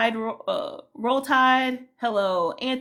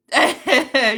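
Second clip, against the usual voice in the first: loud real laugh; burst of laughter; 3 semitones higher, 10 dB louder than usual. Real, not acted. Streamer greets a returning viewer, loud laugh.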